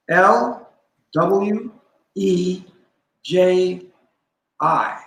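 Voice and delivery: name each letter, L W E J I, one at a time; spelling it out. Shown as a demonstration. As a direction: spell "Elweji" letter by letter with pauses. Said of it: "Can you spell it?".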